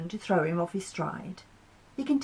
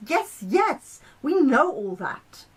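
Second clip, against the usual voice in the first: higher, louder, brushing it off; impatient; 6 semitones higher, 7 dB louder, slower than usual. Acted. Mars interrupts Saturn, impatient and louder. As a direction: cut him short, fed up. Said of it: "Mars was becoming very impatient".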